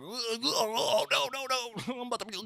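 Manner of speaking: high, hammed-up sick whimper with a crying edge; mock whine. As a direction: whine like you're on your deathbed, half joking. Acting out his sick self.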